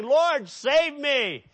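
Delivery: high, loud, drawn-out plea; mock desperate cry. Sinking Peter calling to Jesus for help, crying out. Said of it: "He cried a shriek".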